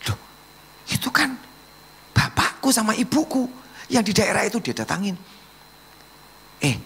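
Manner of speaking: high, animated acted dialogue; excited sermon storytelling. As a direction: act out the astonished exchange at high pitch.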